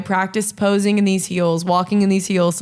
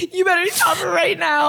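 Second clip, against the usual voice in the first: high-pitched fake crying; mock tearful plea; 8 semitones higher than usual. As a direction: join in with a hammed-up, weepy wail. Joins in the mock meltdown.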